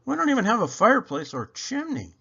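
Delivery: put-on high child's voice, matter-of-fact; little girl voice. A narrator's reading. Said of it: "Rachel, Carlos's sister, says".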